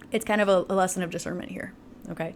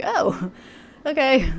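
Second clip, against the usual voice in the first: laughing resigned okay; 6 semitones higher, 4 dB louder, much slower than usual. Retold or imitated. Mimicking a deflated reply.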